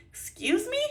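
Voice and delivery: a high, indignant excuse me; incredulous. Real, not acted. Answering a rude remark with high indignation. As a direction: rear up at the cheek of it.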